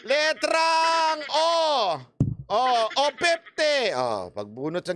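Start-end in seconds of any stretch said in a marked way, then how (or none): 0.1-1.5 very slow, high caller's call; bingo caller. Shown as a demonstration. Demonstrating a bingo draw to viewers, drawn-out caller voice. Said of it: "Ganito maglaro ng bingo". 2.5-3.9 slow, higher caller's call of the number; bingo caller. Shown as a demonstration. Calling the drawn ball for viewers, slow caller voice. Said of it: "Ganito maglaro ng bingo".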